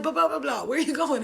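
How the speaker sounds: calling after her. Retold or imitated. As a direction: shout after someone running away. Calling after fleeing girlfriend.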